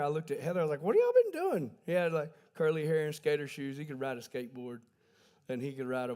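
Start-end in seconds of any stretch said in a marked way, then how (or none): 0.8-1.7 high, loud incredulous squeak; a mock-shocked cry of disbelief; high-pitched mock disbelief at the parents. Asking Heather about the kid.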